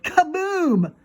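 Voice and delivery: loud high shout; hollered. Acted. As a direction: holler it like an explosion. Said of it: "she hollered the word".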